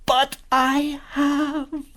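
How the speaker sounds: very slow, high, loud wail; wailing admission. Acted. Faun admitting his deed to Lucy, wailing. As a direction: stretch each word into a howl.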